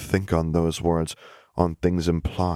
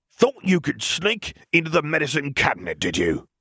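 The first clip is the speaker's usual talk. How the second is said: much higher put-on sneering uncle; a bully catching the girl out; accusing uncle; 9 semitones higher than usual. Vernon accuses Lily.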